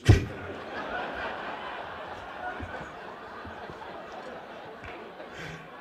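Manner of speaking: breathless unvoiced laugh; airy laughing wheeze; silent wheeze laugh. Real, not acted. Laughing at own joke.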